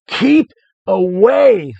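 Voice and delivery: high, loud, slow drawn-out scream. Acted. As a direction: shriek it to drive them off.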